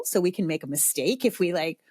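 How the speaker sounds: usual speaking voice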